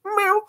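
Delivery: tiny high-pitched mew; soft little falsetto cat cry; imitated cat meow. Imitating the cat presenting the mouse.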